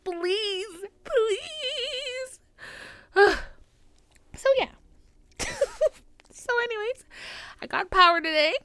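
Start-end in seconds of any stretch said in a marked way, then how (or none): 0.0-2.4 high, drawn-out plea; high mock pleading please; mock begging. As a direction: whine please like a beggar. Mock pleading after laughing.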